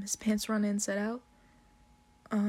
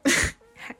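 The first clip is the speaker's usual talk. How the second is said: calling out; 6 dB louder than usual. Retold or imitated. Waking him up, laughing.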